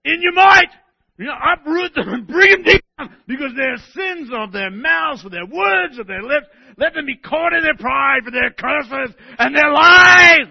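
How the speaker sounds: high, loud, raging cry of vengeance; shouting, furious reading. Shown as a demonstration. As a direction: hurl it like a furious psalmist. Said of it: "Or I can read it go".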